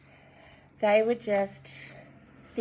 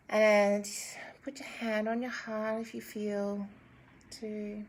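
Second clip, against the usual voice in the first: calm meditation guide; guided meditation; 5 dB quieter than usual. Acted. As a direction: guide them gently into breathing.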